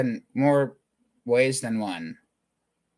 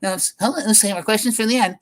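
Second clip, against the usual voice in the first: higher, stalling lecturer voice; mock lecturer deferring questions with a raised pitch; 8 semitones higher, faster than usual. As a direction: put off the class like a flustered lecturer. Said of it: "you can always tell when a lecturer says".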